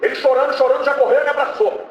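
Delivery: high, fervent preaching voice; preaching at full force. Real, not acted. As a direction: carry the congregation along on a rising fervor. Preaching climax of testimony.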